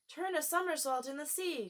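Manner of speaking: high, capering call; lightly voiced wild cry; wild excited cry. A narrator's reading. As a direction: cry out while capering about. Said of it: "cried the Mock Turtle".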